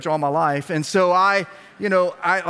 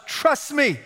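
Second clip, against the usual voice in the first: shouting; 7 semitones higher than usual. Acted. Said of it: "let me tell you what they shout".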